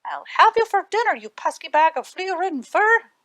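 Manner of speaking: high, loud furious threat; yelling threat. A narrator's reading. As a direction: bellow it down the burrow. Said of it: "the little man yelled".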